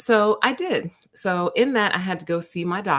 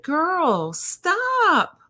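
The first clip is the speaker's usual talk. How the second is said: very high sing-song "stop"; high mock scolding; 11 semitones higher than usual. Telling the nurse off, high playful scolding. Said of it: "This is me talking to the nurse practitioner".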